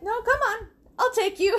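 high sing-song encouragement; high coaxing friend. Retold or imitated. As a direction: coax her along brightly.

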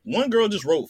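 ordinary delivery

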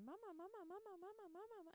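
put-on high, childish calling of mama; whiny little kids. Little kids calling their mom, high and whiny. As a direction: plead like a needy toddler.

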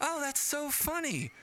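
high, drawn-out mock delight; gushing, high-pitched retold reaction; happy teacher. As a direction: imitate a teacher who is delighted and amused. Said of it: "She was happy".

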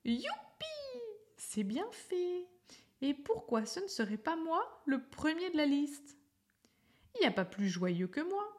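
high, gleeful squeal of Y; jubilant, high-pitched cheering; joyful cry. A narrator's reading. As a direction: crow with delight, pitch way up.

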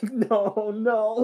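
high, squeaky, scared no; mock panicked no. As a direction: flinch away, pleading. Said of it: "just like".

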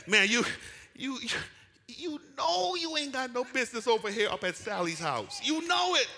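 high-pitched acted scolding; God's scolding voice. God telling a straying sheep it has no business there, acted scolding. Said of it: "and, and God says".

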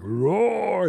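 playful monster roar; mock roar. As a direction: growl the word like the shark. Mimicking the shark's roar.

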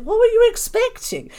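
high-pitched, teasing disbelief; mock indignation. Guest retorts to friends who suspected she was lovely, mock indignant. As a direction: squeal a playfully affronted question.

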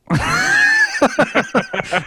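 high laugh rising into a shout; a real howl of laughter. Real, not acted. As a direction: crack up at the deadpan line. Laughs at the quiet reply.

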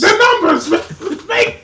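high, loud, drawn-out echo; game character imitation. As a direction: ham up the famous game line, laughing. Echoing the game quote, hammed up.

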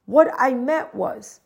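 ordinary delivery

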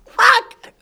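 very high, loud squeaky curse; cringing yelp. Real, not acted. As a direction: yelp it in embarrassment. Cringing at own lyrics.

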